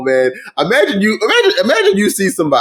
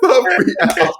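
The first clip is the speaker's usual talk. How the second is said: much higher, slow, laughing through it; 12 semitones higher, slower than usual. Retold or imitated. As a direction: repeat it cracking up. Repeats the quote, laughing.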